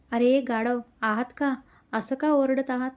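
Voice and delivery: usual speaking voice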